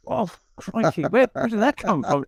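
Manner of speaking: taken aback and baffled; startled. Retold or imitated. Acts out his startled reaction.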